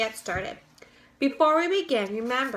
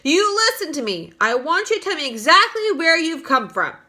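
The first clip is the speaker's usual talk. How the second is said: loud, higher demand; shouting; 5 semitones higher, 6 dB louder than usual. A narrator's reading. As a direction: demand answers through cupped hands. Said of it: "shouted the chief of police".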